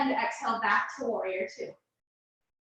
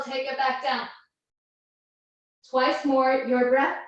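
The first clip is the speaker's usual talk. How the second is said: ordinary talk, nothing marked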